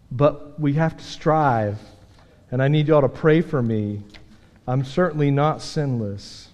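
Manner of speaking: ordinary talk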